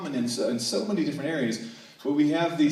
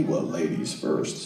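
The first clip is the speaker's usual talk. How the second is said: low, slow Texas gentleman; deep, unhurried Texan drawl; polite Texan man; 8 semitones lower, slower than usual. Acted. Texan man insisting the lady go first, slow low drawl. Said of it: "two Texans trying to out-polite one another".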